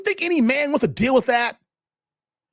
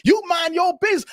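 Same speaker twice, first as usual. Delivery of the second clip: angry retort; 8 semitones higher than usual. Retold or imitated. Retelling the angry woman's shout at him, mock yell. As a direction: snap back like the offended woman. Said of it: "And she's like".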